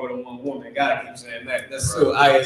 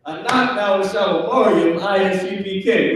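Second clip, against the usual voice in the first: higher-pitched acted kids' recitation; kids answering back; 4 semitones higher than usual. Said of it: "You better say it like that".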